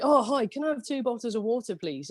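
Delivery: acted-out polite ask; polite request. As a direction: re-enact a chirpy polite order. Retelling her own polite order abroad.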